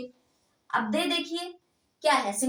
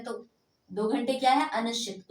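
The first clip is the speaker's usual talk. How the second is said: ordinary talk, nothing marked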